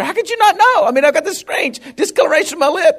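high-pitched, panicky protest; mock panic. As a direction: act out a worried patient getting worked up. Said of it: "beginning to get more panic".

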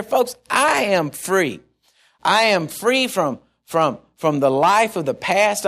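slow, weighty, each word spaced; drawn-out preacher testimony; slow declaration. Real, not acted. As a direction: lean on I am free, slowly. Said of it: "slow declaration of freedom".

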